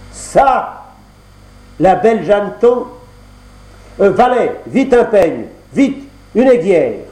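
plus aigu, plus fort, stupéfait; surprise indignée. Acted. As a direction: sursaute puis commande en hâte. Le prince découvre la tromperie. (le fils du roi découvre la créature, stupéfait et aigu)

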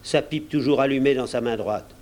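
plain reading voice

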